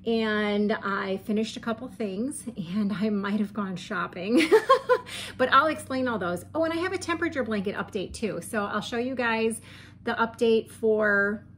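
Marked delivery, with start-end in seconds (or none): 4.5-5.3 a short giggle that jumps high; sheepish high laugh. Real, not acted. Admitting to viewers she went shopping, giggling high. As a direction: laugh it off, guilty about shopping.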